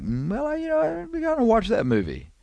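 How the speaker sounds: high, hesitant put-on voice talking himself into it; hammed-up high voice of a tempted moviegoer; tempted viewer. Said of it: "you're kinda like".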